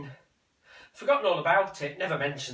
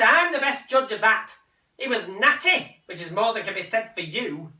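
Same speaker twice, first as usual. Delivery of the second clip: high, loud, indignant; angry mother; 6 semitones higher, 6 dB louder than usual. Acted. Mother rounding on son, high and angry. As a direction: hit back at him loudly.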